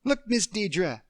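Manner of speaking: put-on high, eager little girl calling out; excited child. Emily pointing Deirdre toward the ocean, excited. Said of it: "The excitement in Emily's voice".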